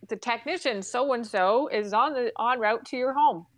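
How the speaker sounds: usual speaking voice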